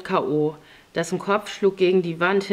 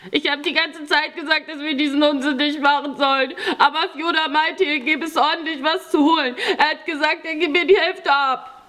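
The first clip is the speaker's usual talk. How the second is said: put-on high-pitched blubbering boy's voice; tearful whining; 10 semitones higher than usual. A narrator's reading. Frightened boy excuses the robbery to Pawel, tearful whining. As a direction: wail out the excuse, close to sobbing. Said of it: "sagte er flennend".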